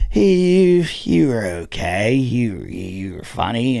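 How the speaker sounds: put-on halting, repetitive drunken slur; drunk heckler. Drunk heckler grading the comics at dinner, slurred. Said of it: "he's all fucking drunk".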